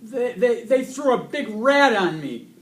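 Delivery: high, loud, stammering wail; almost in tears. A narrator's reading. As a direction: wail a tearful complaint. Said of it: "He was almost in tears".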